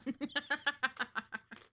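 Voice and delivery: high laugh at own joke; loud burst of laughter. Real, not acted. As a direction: break out laughing.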